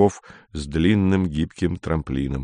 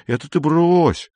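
higher, slow and threatening; menacing; 8 semitones higher than usual. A narrator's reading. Lin turning on Atos, threatening. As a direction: lean in and warn him off. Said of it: "грозно сказал Лин".

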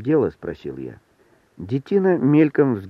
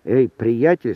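usual speaking voice

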